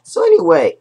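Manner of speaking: real voice crack, jumping high; sudden high crack. Real, not acted. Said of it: "sometimes my voice was a little pitched".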